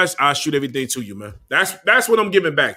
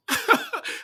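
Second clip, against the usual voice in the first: breathy mocking laugh; 13 semitones higher, 7 dB quieter than usual. Real, not acted. Laugh mid taunt.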